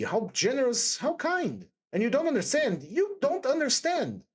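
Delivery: pained, high-pitched outpouring with broken starts; despairing. A narrator's reading. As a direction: plead to be understood, pitch lifting. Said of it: "Sonia said this as though in despair".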